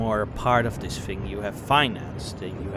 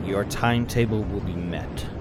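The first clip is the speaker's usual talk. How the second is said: usual speaking voice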